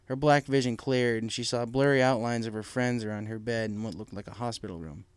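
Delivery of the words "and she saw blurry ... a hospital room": usual speaking voice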